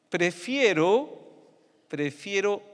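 "prefiero" high, loud and slow, rising; emphatic prefiero. Shown as a demonstration. Preacher pressing the key word to the congregation, loud and high. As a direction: lean hard on "prefiero", repeated. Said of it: "escucha este término".

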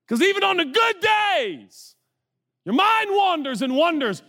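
high, driving sermon shout; impassioned preaching. Real, not acted. Preacher challenges congregation's assumptions, real high-pitched impassioned preaching. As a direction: preach it hard, stretching key words.

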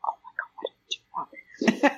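worried whisper to herself; nervous whisper. Retold or imitated. Scared thought when called in.

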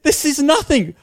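squeaky high-pitched voice, loud; mock himself in a shrill falsetto; high mock self voice. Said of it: "I was like".

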